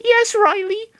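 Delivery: obey his trainer in a tiny voice; high Lucario voice. Acted. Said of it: "Lucario said".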